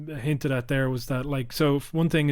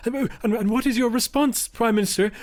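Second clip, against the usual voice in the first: higher, timid questioning voice; mock Ed Miliband; 9 semitones higher than usual. Mocking Ed Miliband asking the Prime Minister, higher and timid. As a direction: squeak it out like a feeble debater. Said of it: "He's just there going like".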